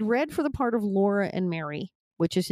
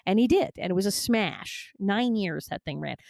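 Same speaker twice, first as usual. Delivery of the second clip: normal talking voice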